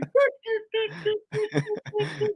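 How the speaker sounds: high, soft staccato video-game blips; Atari beeps. Shown as a demonstration. Comic showing his crude Atari visuals, high stuttering beeps. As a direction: imitate an old eight-bit game with quick high noises. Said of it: "Mine is like Atari eight-bit".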